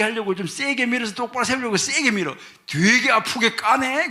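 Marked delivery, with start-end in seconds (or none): 2.7-4.1 slow, high, loud mock whine; mock pained complaint. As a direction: drag it out, pretending it hurts. Complaint about being pushed hard. (느리고 높게 과장된 불평)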